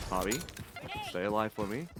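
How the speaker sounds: ordinary delivery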